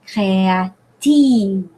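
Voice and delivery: créative very slow, stress landing on the final syllable; drawn-out French créative, weight at the end. Shown as a demonstration. Teacher showing French stress to students, slow créative stressed at the end. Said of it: "In French".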